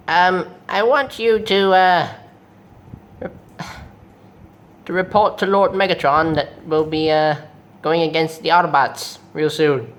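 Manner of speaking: lightly voiced scheming robot villain; Starscream. Starscream asking Jetfire to report to Megatron, villain voice. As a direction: give orders haltingly, in character.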